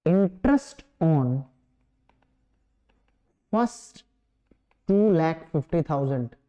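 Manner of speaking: measured dictation, one phrase at a time; drawn-out words, dropping away at phrase ends; slow dictation. Real, not acted. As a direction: leave long gaps for students writing. Dictates answer for students to write.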